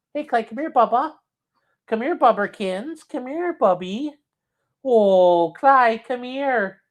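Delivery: slow, high cooing for a pup; calling the dog. Real, not acted.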